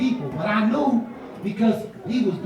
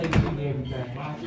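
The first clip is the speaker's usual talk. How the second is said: low and cool, no big deal; laid-back friend; 7 semitones lower, 5 dB quieter, much faster than usual. Retold or imitated. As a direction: lower easygoing friend voice. Friend asks.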